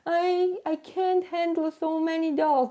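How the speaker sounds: mock her own overwhelmed whining; whiny self-parody. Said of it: "I'm making fun of myself".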